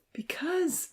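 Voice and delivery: whispered high plea; softly pleading, almost breathless. Acted. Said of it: "Evan pleaded".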